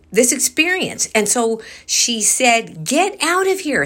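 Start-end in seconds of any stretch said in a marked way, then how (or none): 2.9-3.8 retold yell. Retelling how the old woman ordered the thing away, mild mock yell. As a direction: fling it out like she's shooing something off. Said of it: "So now she's yelling at whatever".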